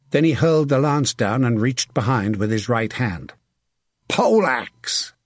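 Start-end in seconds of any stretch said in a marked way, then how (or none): 4.1-5.1 high, urgent battle call; raised-pitch command; called-out demand. A narrator's reading. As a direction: call sharply to your squire for a weapon. Knight calls squire for weapon.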